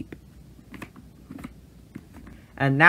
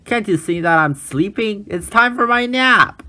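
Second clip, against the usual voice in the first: cross; 7 semitones higher than usual. Acted. Bird scolds animals.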